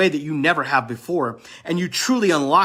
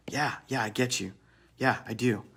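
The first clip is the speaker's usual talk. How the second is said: put-on softer, murmured answer from the girlfriend; soft reassured reply; 4 semitones lower, 8 dB quieter than usual. Girlfriend's reply in acted talk.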